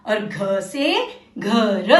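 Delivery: very slow, drawn-out words for repeating; slow read-along. Shown as a demonstration. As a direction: stretch each word so kids read along. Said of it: "मेरे साथ साथ आप भी बोलना।".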